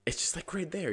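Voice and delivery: pleading that it's so close; exasperated. Real, not acted. Frustrated runner blocked by the crossing, exasperated high plea. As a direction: pitch jumps way up in disbelief.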